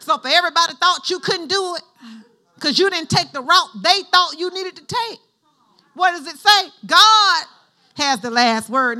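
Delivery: loud, rising preacher's build; preaching at full force. Acted. Preacher rallying the congregation, loud fervent build.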